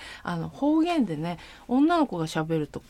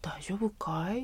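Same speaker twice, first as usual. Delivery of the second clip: whispered, slow Hokkaido-dialect question; soft Hokkaido "大丈夫かい？" like a gentle local boy; 6 dB quieter, slower than usual. Shown as a demonstration. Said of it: "男子の。".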